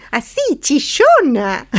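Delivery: high, squeaky all-timbre voice; shrill voice. Shown as a demonstration. Voice teacher demonstrates talking only with timbre, shrill and high. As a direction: squeal it out high, stretching the word. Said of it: "si vos hablas solo con el timbre".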